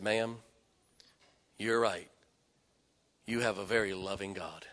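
soft, low reassurance; calm her down gently; soft pastor soothing a grieving mother; gentle calm. Retold or imitated. Pastor calming angry woman.

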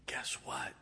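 conspiratorial whisper; hushed, voiceless aside. Retold or imitated. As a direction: lean in and whisper it like a secret. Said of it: "I was whispering".

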